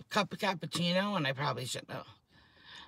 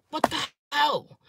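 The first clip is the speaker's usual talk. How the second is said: real upset cry; 10 semitones higher, 5 dB louder, slower than usual. Real, not acted. Upset about salon workers.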